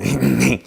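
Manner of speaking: Beavis cackle pushed up high and loud. Shown as a demonstration. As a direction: demonstrate a squealing cartoon snicker. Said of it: "kinda higher register of".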